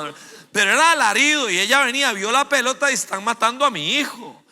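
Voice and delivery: ordinary delivery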